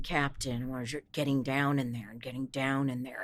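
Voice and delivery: low, hushed Princess Leia voice; Carrie Fisher. Shown as a demonstration. Actor recalls matching Princess Leia, low hushed impression. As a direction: drop deep and soft into an older Leia. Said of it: "to Carrie Fisher for the Princess Leia".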